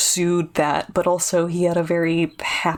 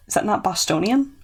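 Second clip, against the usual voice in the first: normal talking voice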